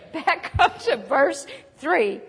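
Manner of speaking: slowed, laughing through the words. Real, not acted. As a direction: chuckle while repeating the instruction.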